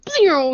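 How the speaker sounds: a quick high pew, like a toy laser; mock pew. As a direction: fire off a cartoon blaster noise. Kid firing rocket sound effect.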